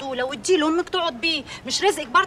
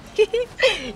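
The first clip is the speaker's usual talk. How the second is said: short, high scornful laugh; mocking laugh; 9 semitones higher, 5 dB louder than usual. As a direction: laugh at her naivety. Laughs at friend's naive question.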